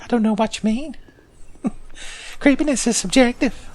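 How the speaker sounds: creepy high-pitched ghost voice; Gower. Acted. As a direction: play innocent as a creepy, high-voiced hanger-on. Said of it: "could you be less creepy about it".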